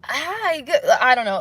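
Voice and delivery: fake polite reply. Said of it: "when I do the fake mask thing".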